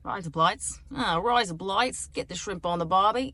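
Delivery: playful put-on Australian accent. Shown as a demonstration. Showing how the phrase gets you right into it, Australian accent. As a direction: ease into a broad Australian accent. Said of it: "get into the Australian accent".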